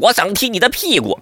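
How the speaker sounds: 老译制片腔. Retold or imitated. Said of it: "还不是传统意义上".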